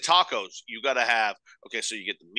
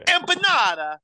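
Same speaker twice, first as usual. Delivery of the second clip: 높고 과장되게 늘인 라틴 억양 흉내의 "Empanada"; "Empanada"를 과장된 라틴 억양으로 길게 뽑기; mock Latin American accent; 13 semitones higher, 5 dB louder than usual. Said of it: "not appropriating culture".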